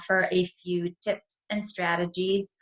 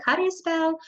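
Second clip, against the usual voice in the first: put-on child asking; 13 semitones higher, 3 dB louder than usual. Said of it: "If your child asked you".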